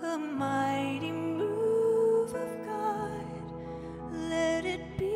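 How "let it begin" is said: ordinary talk, nothing marked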